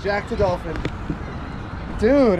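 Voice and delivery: ordinary talk, nothing marked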